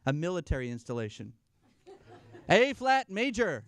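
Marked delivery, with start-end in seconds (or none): 2.5-3.6 booming punchline. Acted. Punchline of piano joke.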